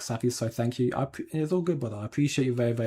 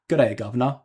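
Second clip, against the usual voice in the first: louder, hammed-up Cockney greeting; broad Cockney; 5 dB louder than usual. Streamer nails the viewer's request in broad Cockney. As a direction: go full Cockney on it. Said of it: "If we say it more Cockney or".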